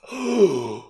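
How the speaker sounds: sharp theatrical intake of breath; big scandalized gasp; offended gasp. Acted. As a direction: gasp in mock outrage. Mom reacts, offended.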